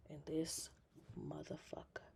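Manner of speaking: whispered insult. Real, not acted. Owner telling the cat story whispers the insult. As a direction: hiss it under the breath.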